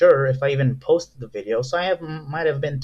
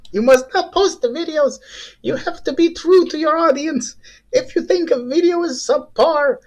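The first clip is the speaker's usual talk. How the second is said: much higher, earnest angel on the shoulder; 11 semitones higher than usual. Pretend. The good conscience warns him not to post, in a high saintly voice. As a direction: preach honesty in a high, saintly voice. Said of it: "doing the voice like this".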